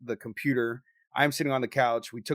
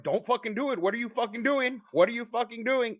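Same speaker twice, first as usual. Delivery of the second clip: high-pitched retold alarm; panicked inner voice; 9 semitones higher than usual. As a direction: plead with yourself to stop, voice pitched way up. Retells inner voice begging himself to stop.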